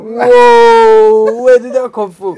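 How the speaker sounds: loud, high, amazed cry; startled outburst. Real, not acted. Host reacts to friend's sudden impression, loudly astonished. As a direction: burst out in noisy astonishment.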